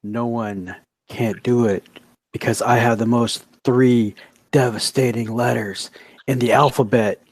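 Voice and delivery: low, halting promo delivery with pauses between phrases; choppy wrestling promo. Acted. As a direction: cut a promo in slow, measured chunks. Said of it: "choppy like that".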